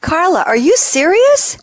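high, astonished question; incredulous. Real, not acted. Host reacting to car-buying statistic, incredulous. Disbelief at test-drive statistic.

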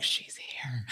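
whispered aside among coworkers; gossipy whisper. Retold or imitated. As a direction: hiss it behind a hand. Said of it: "Everyone was like".

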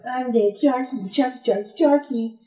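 high, taunting play-dead voice, singsong sharky; fish playing dead. Fish taunting a shark while playing dead, high mock singsong. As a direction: tease the shark in a high sing-song. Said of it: "They're like".

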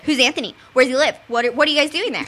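the fairer take on the prying mom; toned-down mock mom. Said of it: "She'd be like".

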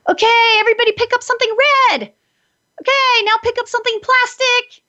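put-on high, fast, bright game-host voice calling out to the kids; playful game-leader call. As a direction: sing it out like a fun game. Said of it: "you make it a game".

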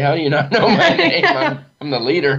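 put-on amused, half-laughing, retelling how a kid forgot his name; chuckling as he mimics his own surprised reply; laughing disbelief.

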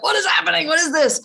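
high, bewildered mock confusion; baffled. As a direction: replay her first-yoga puzzlement. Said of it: "I was like".

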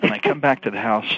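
ordinary talk, nothing marked

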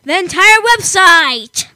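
slow, loud proud announcement; excited. Real, not acted. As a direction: proudly spell it out for listeners.

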